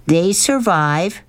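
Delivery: drawn-out, high-pitched insistence; arguing. A narrator's reading. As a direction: stretch it out to argue back. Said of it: "argued Sistine".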